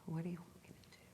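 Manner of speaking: quick, barely audible whisper; hushed, rapid question to oneself. Real, not acted. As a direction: whisper it privately to the voice inside. Repeats question to her inner voice.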